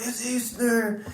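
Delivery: put-on high, drawn-out kid's cheer; excited little sister. Little sister waking brother on Easter morning, excited high squeal. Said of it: "was all excited".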